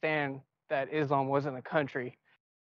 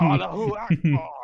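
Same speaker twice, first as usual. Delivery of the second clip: mock shout; 6 dB louder than usual. Mock wrestling show shout.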